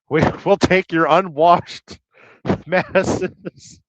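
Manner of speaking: breaking into laughter mid-sentence; laughing. Real, not acted. Host joking that America takes illegals and convicts, cracking up. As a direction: crack up as you get the words out, slowly. Cracks up at his own joke.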